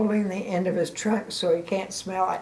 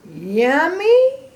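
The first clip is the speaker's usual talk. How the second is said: high, louder incredulous yummy; can't believe anyone eats this; disbelieving Gerald; 8 semitones higher, 6 dB louder than usual. Acted. Grandma voicing Gerald doubting Piggy's slop.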